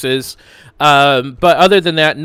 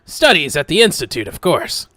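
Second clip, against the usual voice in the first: louder, higher know-it-all scholar voice; Alex, pompous know-it-all adventurer; 3 semitones higher, 8 dB louder than usual. Acted. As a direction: play him as a self-important scholar. GM plays Alex in character.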